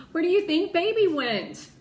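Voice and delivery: high, loud worried ask; anxious question. Acted. Piper asks Ree where the dog went, high worried plea. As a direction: plead for an answer.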